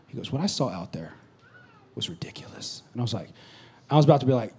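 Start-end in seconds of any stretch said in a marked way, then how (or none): 0.1-2.8 low, soft, tense hush; hushed tense telling. Retold or imitated. Players waiting for the coach's verdict, hushed. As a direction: hold the dread quietly.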